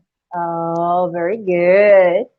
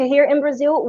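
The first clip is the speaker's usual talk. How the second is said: ordinary talk, nothing marked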